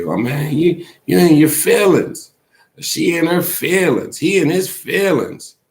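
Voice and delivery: mock the folks who say you're in your feelings; mocking people. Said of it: "People say".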